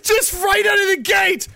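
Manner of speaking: high, laughing shout; gleeful shouting at the stream; excited shout. Real, not acted. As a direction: yell it in delighted disbelief. Cheering the fighter's question.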